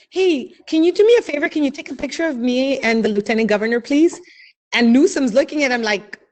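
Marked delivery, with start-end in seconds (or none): 0.1-4.2 put-on higher, bold kid asking a favor, the middle part rushed; eager kid request. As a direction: imitate the cheeky kid asking the governor. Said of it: "My son looks over and says".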